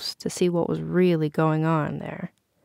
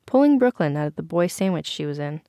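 ordinary delivery, nothing marked

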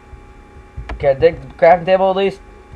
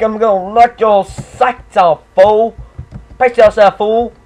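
tough guy trash talk; 5 semitones higher, 4 dB louder, slower than usual. Acted.

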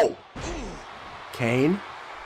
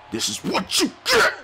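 shouted taunt, much higher. Pretend. Captain Falcon taunting Kane, high shout. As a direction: yell it as he strikes.

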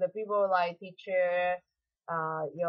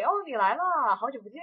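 你来了 much higher and louder, bouncing with joy; happy greeting; 7 semitones higher, 6 dB louder than usual. Shown as a demonstration. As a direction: greet a friend you're thrilled to see. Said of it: "So you're very happy".

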